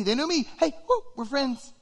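high, delighted exclamation; giddy excitement. Acted. Fan realizing someone special knows them, giddy excitement. Acting out delight at being known.

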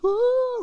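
high, loud "woo"; excited whoop. Acted. Listener imagined getting Oprah's call, excited whoop. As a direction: let out a long high whoop. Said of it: "you'd be like".

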